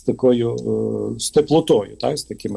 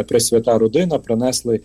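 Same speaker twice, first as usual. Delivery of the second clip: ordinary delivery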